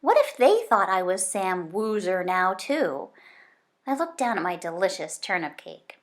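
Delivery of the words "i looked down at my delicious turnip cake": plain reading voice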